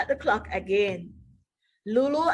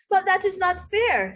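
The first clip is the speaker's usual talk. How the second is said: Mo protesting; 7 semitones higher than usual. Acted.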